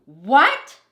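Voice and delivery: outraged cry. Acted. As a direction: shout it out in disbelief. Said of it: "she cried".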